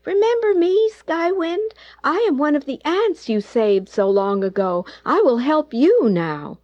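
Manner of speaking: high, eager tiny-creature voice; a small ant piping up to repay a debt; little ant voice. A narrator's reading. A grateful ant telling Skywind it will help, high and eager.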